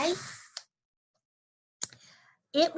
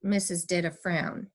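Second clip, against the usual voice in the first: usual speaking voice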